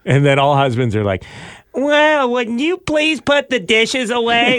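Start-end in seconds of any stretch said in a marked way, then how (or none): 1.7-4.6 much higher, slow and prim; a hammed-up nagging wife; husband mimicking his wife in a high voice; mock wife impression. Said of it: "the typical wife impression".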